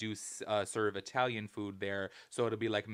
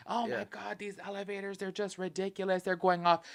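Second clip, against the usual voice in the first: mock whiny passenger; 8 semitones higher than usual. Mocking complaining passengers.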